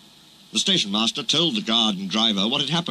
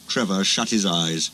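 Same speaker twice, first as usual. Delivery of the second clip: ordinary delivery, nothing marked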